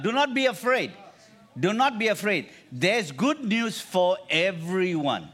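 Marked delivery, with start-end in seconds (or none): 0.0-2.5 high, loud insistence on not; loud reassurance. Acted. Preacher insisting to the congregation, loud. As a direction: drive the reassurance home.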